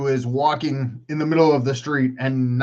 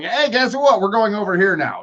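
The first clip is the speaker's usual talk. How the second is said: excitable squirrel; 5 semitones higher than usual. Acted. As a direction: pipe up as an interrupting squirrel. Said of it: "that is my squirrel going".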